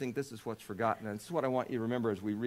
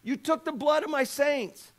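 high, forceful preacher voice; impassioned preaching; 13 dB louder, slower than usual. Acted. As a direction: thunder it out like a preacher.

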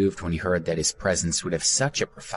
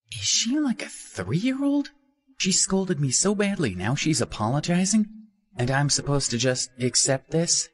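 higher, incredulous musing; indignant inner thought; 10 semitones higher than usual. A narrator's reading. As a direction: scoff at her in your head.

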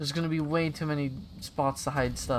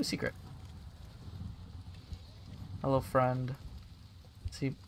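usual speaking voice

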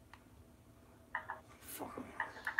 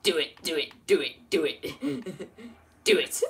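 Palpatine impression. Giving in to his friend's request, a mild Palpatine. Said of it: "I can't really do it".

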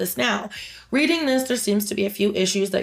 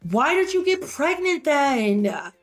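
slow, higher, incredulous; exasperated retort; 6 semitones higher than usual. Real, not acted. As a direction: ask it in disbelief, dragging it out. Exasperated retort to mocked mom.

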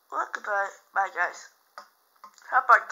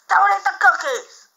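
loud playful yell on DON'T; shouted warning; 9 dB louder than usual. Pretend. Kid yelling at the cookie thief, loud.

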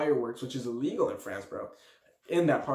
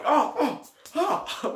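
imitated freaking out; 11 semitones higher, much slower than usual. Dodging embers on his skin, imitated freaking out. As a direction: act out flinching in panic. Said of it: "I'm freaking out".

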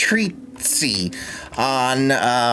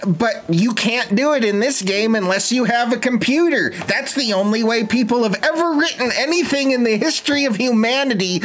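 mock-lecturing, pitched high; sarcastic rant; 8 semitones higher than usual. Gamer mocking the game's writing rules, sarcastic. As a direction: lay on the sarcasm like a know-it-all.

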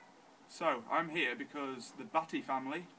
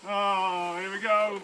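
loud nervous high burst; psyching yourself up, loud; bracing for it; 4 semitones higher, 9 dB louder, slower than usual. Real, not acted. About to get ice water.

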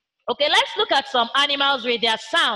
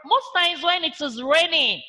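usual speaking voice

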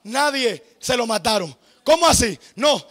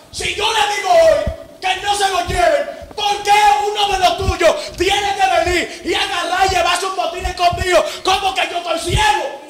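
angry shout; 3 semitones higher than usual. Acted. God rebukes Joshua's people.

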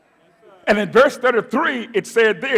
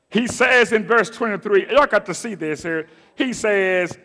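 ordinary talk

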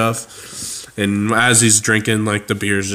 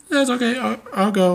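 squeaky innocent dad; 13 semitones higher than usual. Retold or imitated. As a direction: play it falsely casual in a high voice. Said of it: "Frank will say".

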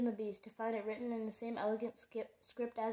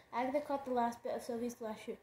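plain reading voice

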